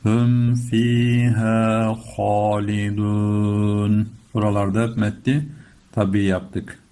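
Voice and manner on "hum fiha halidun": very slow, fuller sung chant; Quran recitation. Shown as a demonstration. Teacher repeats the chanted line, slower and fuller. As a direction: draw "Hum fiha halidun" out, fuller.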